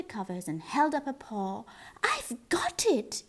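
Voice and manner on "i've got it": high, slow whispered eureka; excited mouse whisper. Acted. The mouse, waking at night, whispers his big idea. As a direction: breathe it like a tiny mouse with an idea. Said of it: "he said".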